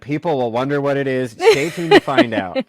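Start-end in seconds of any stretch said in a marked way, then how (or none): 1.3-2.6 announcer voice. Pretend. Mock announcer teaser.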